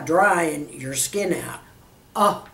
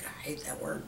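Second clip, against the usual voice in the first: voiceless whisper, much softer; whispered aside; 4 semitones lower, 18 dB quieter than usual. Real, not acted. Vlogger confides distaste for the word, whispered. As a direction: drop to a secret whisper for the aside. Aside while describing sunken eyes.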